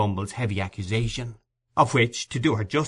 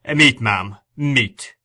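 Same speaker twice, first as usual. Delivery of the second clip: Meat stressed firmly, louder; stern emphasis; 6 dB louder, much slower than usual. A narrator's reading. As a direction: press each meat sternly. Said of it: "replied Bumble with stern emphasis".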